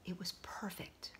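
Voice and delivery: whispered awe; hushed. A narrator's reading. Narrator marveling at the stone, whispered. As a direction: breathe it reverently.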